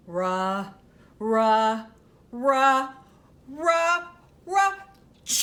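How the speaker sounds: building ah-ah-ah into a big sneeze; huge storybook sneeze; mock sneeze. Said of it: "he blows and he sneezes".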